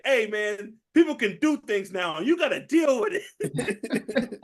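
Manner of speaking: mock the bartender's advice, laughing through it; hammed-up Trejo line. Danny Trejo quote again.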